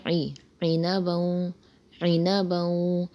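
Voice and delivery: عِنَبًا with a throaty ain at the start; عِنَبًا with a clear ain; ain sound. Shown as a demonstration. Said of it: "عین کی آواز نکالیں".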